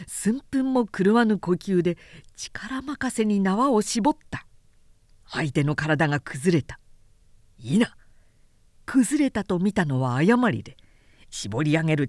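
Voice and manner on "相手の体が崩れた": usual speaking voice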